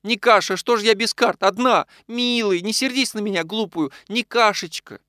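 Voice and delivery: high, imploring wail; plaintive wife's entreaty, softening as it goes; tearful pleading. A narrator's reading. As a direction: beg forgiveness in a high voice. Weeping wife pleads with angry husband.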